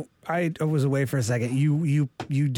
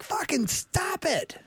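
high, slow mock yell; mock pained yell; 13 semitones higher, 3 dB quieter, much slower than usual. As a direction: drag it out like you can't take it. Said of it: "You're like".

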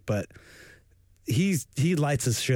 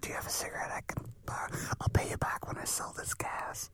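whisper; 2 semitones higher, 11 dB quieter than usual. Pretend. Gas thief whispering again.

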